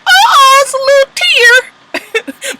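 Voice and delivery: very high, drawn-out mock whimper; mock tearful. Mimicking a tearful moment on the show, mock tearful. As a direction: squeak out a slow, teary coo.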